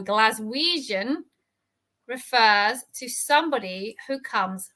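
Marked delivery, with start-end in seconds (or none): 0.0-1.2 careful model word. Shown as a demonstration. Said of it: "and from Glasgow".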